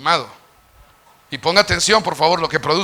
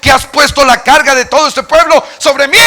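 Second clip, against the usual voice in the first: raised, pleading cry of a burdened leader; Moses' anguished complaint; 7 semitones higher, 9 dB louder than usual. Acted. Preacher voicing Moses crying out to God, anguished.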